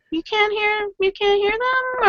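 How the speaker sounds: high, exasperated mock plea; mock disbelief. Bystander to a mom ignoring her screaming kid, mock disbelief. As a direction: squeal it in exaggerated disbelief.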